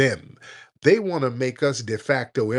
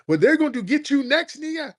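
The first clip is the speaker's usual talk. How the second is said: mock taunter; 13 semitones higher than usual. Mocking a taunting immigrant.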